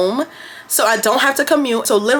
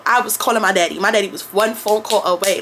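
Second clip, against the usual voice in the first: usual speaking voice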